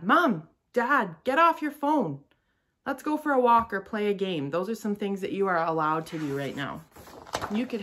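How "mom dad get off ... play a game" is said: put-on higher, louder child pleading; kid voice. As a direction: call out like a kid to parents. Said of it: "you could say to your mom or dad".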